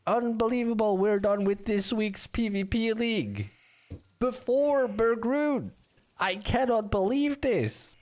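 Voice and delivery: high-pitched, astonished commentary; amazed announcement. Real, not acted. Caster amazed the league is done.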